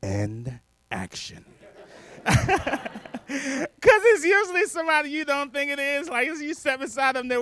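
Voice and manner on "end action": low, slow, spaced-out cue; doors as director's cue. Retold or imitated. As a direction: intone it slowly, low, like a cue on set. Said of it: "sounds like this to a crazy person".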